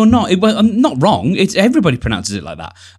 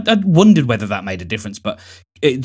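normal talking voice

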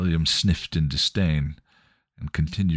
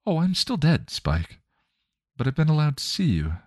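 lightly voiced kind mother, a bit higher; Joyce, gentle mother; 10 semitones higher than usual. Joyce's spirit reassuring Spike, lightly voiced gentle mother.

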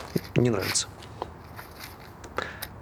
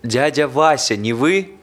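louder and higher, checking if he's heard; calling louder; 5 semitones higher, 7 dB louder, slower than usual. Acted. Visitor calls louder to an old patient, checking. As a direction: raise the voice to reach a half-deaf old man.

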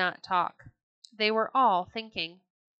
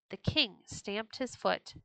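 plain reading voice

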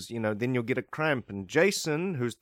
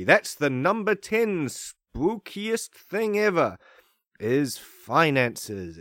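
slow, drawn-out countdown voice; dramatic announcer; 3 semitones higher, 4 dB louder, slower than usual. Pretend. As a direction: stretch it like a horror reveal.

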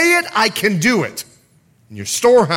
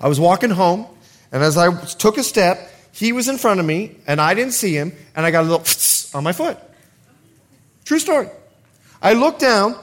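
usual speaking voice

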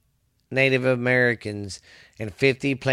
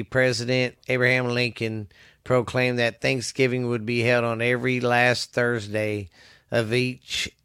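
ordinary talk

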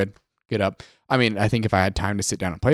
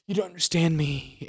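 mock emo teen; 5 semitones higher, 6 dB quieter than usual. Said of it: "I'm like".

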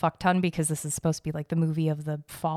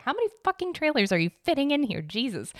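high-pitched exasperated griping; exasperated rant, pitched up; 7 semitones higher than usual. Retold or imitated. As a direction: vent in mock disbelief, voice pushed up high. Quoting own exasperation at trailers.

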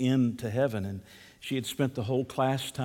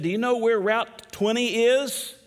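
high, raised-voice question; lost man asking; 9 semitones higher, 3 dB louder than usual. Retold or imitated. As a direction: play a lost traveller quizzing a boy. Lost man asks the boy.

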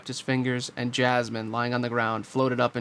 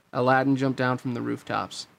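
ordinary delivery

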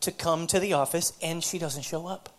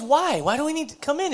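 much higher, put-on questioning voice; reluctant protest; 10 semitones higher, 6 dB louder than usual. Woman asking counselor why she must come, reluctant protest. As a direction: challenge it with a mock-pleading why.

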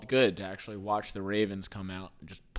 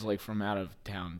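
ordinary talk

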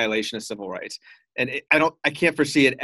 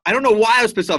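angry; 7 semitones higher, 7 dB louder, much faster than usual. Real, not acted. Venting about offensive tweet.

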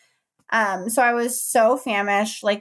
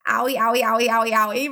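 "owie" chanted over and over; a run of owies at normal pitch; repeated owie; faster than usual. Retold or imitated. Mother retelling her labor cries, repeated owie. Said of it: "repeatedly over and over".

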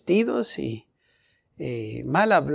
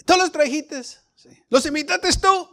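suspicious church member; 10 semitones higher than usual. Retold or imitated. Hermanos interrogan al predicador.

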